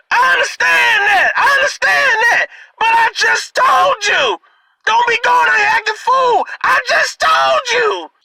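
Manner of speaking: high, strained yell with a sob in it; granddaddy yelling. Retold or imitated. Grandfather scolding his sobbing daughter, high strained yell. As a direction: shout it like a grandfather at his wits' end. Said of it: "my granddaddy is holding her up saying".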